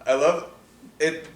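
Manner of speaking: ordinary talk, nothing marked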